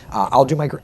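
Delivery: usual speaking voice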